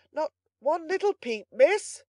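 high, loud, eager plea; proud coaxing. A narrator's reading. Butler begging miss to admire his catch, eager coaxing. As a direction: wheedle for just one look. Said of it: "with as much pride as if Oliver".

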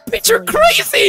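high, slow, loud yell; laughing shout. Real, not acted. Reactor yelling at the villain girl, loud high shout. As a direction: yell it at the screen in disbelief.

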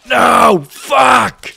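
loud furious yell; angry shout. Real, not acted. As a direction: shout it in real rage. Falls in game, shouts.

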